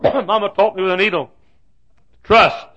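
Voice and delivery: high, loud declaration; emphatic preaching. Real, not acted. Preacher driving home his mama's lesson, loud and high. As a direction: proclaim the lesson at full force. Refrain repeated at full force.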